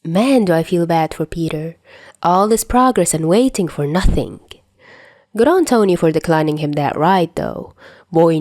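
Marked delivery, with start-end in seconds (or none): none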